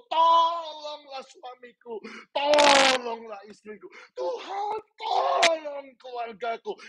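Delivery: high, long-drawn pleading shouts; crying out. Real, not acted. Preacher leading congregation pleading for family, crying out. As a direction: wail each plea out, stretching "tolong". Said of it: "bersama-sama berseru teriak".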